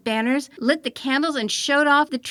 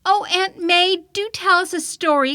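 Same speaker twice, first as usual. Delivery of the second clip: put-on clamoring children; 6 semitones higher, 4 dB louder than usual. A narrator's reading. The younger children beg Aunt May for a story, higher and clamoring. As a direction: beg like eager little ones. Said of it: "clamored the younger children".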